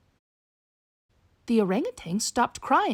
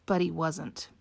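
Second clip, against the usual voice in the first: usual speaking voice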